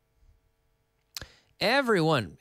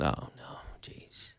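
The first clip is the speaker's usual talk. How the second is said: whispered groan of dismay; dismayed muttering; 13 semitones lower, 12 dB quieter, slower than usual. Retold or imitated. Patient told he needs a filling, whispered dismay. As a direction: breathe it out, barely audible. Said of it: "I said".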